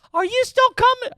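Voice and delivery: mimicking his mom calling to ask if they're still coming; imitating mom. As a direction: squeak it like a fretting mom. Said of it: "Now my mom's call".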